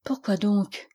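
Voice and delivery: understated mysterious question. A narrator's reading. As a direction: ask it with a touch of mystery. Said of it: "d'une voix mystérieuse".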